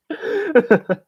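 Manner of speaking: squeaky burst of laughter; high-pitched laugh. Real, not acted.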